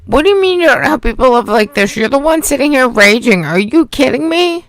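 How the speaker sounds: high, loud and fast, in disbelief; exasperated shout at the ranting streamer; incredulous outburst. Real, not acted. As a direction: fire it back at her, can't believe it. Co-host exclaims at her raging.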